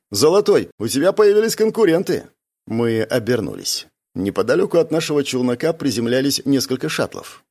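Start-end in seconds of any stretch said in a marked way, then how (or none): 0.1-2.3 teasing call. A narrator's reading.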